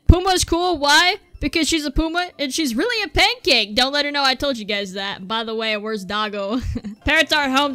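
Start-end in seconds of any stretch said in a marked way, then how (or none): none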